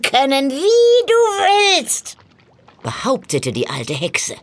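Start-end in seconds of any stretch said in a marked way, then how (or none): 0.0-2.2 put-on old witch voice swooping up high on key words; croaking witch, tempting. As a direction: lure him with treasure as a theatrical old hag. (lockende Hexe mit krächzender Stimme)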